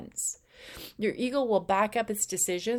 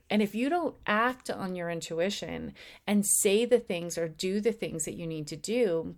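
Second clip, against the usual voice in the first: ordinary talk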